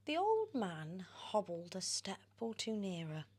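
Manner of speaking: usual speaking voice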